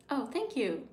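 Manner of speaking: ordinary talk, nothing marked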